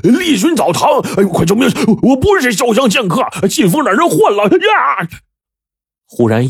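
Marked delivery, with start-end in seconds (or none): 0.0-5.1 high desperate scream for help; screaming in terror. A narrator's reading. Beaten man screams from inside the room, terrified. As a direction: shriek it out as if being mauled. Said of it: "惨叫了一声说".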